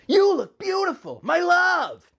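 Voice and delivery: very high, loud put-on lover's cry; Link's voice. A narrator's reading. Link greeting his wife at the stairs, adoring.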